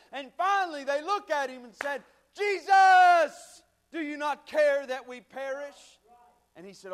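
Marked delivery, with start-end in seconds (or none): 2.3-6.0 loud, high shout for help; desperate cry. Acted. Disciples waking Jesus in the storm, desperate cry. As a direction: cry out as if the boat is sinking.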